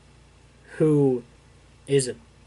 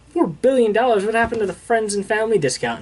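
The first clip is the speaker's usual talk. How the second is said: higher-pitched, mildly incredulous retelling; mock indignant surprise; 7 semitones higher, faster than usual. Rhodes balking at Tony's price for new armor, mock indignant surprise.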